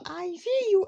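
put-on high, loud creepy old woman; creepy granny voice. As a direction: squeal it like a horror granny. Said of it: "la nonna fa". (voce da nonna inquietante)

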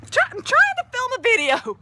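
fed-up plea to the pet; exasperated protest. Real, not acted. Pet interrupting filming.

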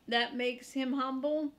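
usual speaking voice

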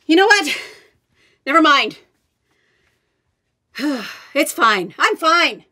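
loud, exasperated call from downstairs; flustered calling out. Acted. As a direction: brush it off, yelling up. Flustered, calling up after falling.

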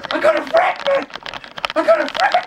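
squeaky, very high delight; high-pitched squeal. Shown as a demonstration. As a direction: squeal it like a thrilled kid. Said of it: "repeats higher".

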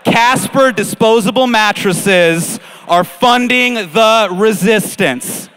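loud, slow, weighty proclamation; hammed-up revolutionary speech; mock rallying cry. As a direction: declare it to the crowd, word by word. Mock rallying cry about sponsors.

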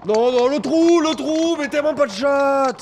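high loud cry of dismay; panicked shout. Real, not acted. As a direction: yell as the ball misses. Panics as ball misses hole.

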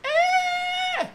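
very high long shriek; drawn-out high-pitched "aah"; child's distant scream. Acted. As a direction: imitate a terrified little kid screaming. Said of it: "she just hears".

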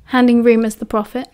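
ordinary delivery, nothing marked

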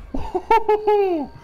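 high, loud laughing "woo"; gleeful whoop. Real, not acted. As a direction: whoop with delight. Celebrating in the fight.

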